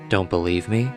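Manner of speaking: normal talking voice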